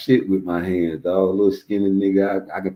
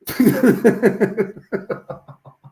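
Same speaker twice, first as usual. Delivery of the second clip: loud laugh; 14 semitones higher, 5 dB louder than usual. Real, not acted. Laughs at story.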